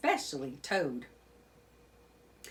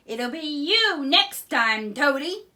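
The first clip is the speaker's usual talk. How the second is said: high, slow, sneering weasel voice; gleeful weasel threat; 9 semitones higher, 10 dB louder than usual. A narrator's reading. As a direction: gloat over Toad like a sly weasel. Weasel taunting Toad.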